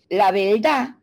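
"La verdad" as "la belda", with an L for the R; Puerto Rican accent. Retold or imitated. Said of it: "un puertorriqueño te dice".

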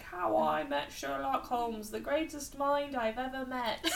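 mock-British narrator holding back laughter; British accent. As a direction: put on a playful, high-pitched British voice. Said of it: "How's my British accent?".

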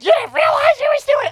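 very high, fast shrieked echo; imitated furious mother. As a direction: mimic a mother screaming in disbelief. Furious mother echoes his excuse.

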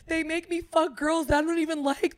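high, loud, hammed-up tearful wail; mock crying. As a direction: imitate a woman bawling her complaint. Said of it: "She's now crying. She's like".

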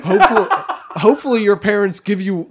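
high, loud laughing words. Real, not acted. Laughing through line.

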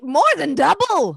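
high, loud pompous announcer. Acted. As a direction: stress double grandly. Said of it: "the announcer says".

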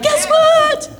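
high sung guess what; sing-song guess what. Retold or imitated. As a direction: chirp it like a tune.

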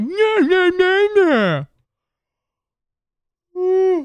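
high, drawn-out nah nah; lazy body saying no, high; lazy refusal voice. Pretend. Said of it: "my body's just like".

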